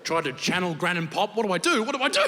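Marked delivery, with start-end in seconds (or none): 1.4-1.8 a touch of panic, higher; first panicked question, still half-held; panicking. Retold or imitated. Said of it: "I'm freaking out". 1.8-2.2 even higher, laughing panic; the panic spikes on the repeat; second take climbs higher in mock panic; rising panic. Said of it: "I'm freaking out".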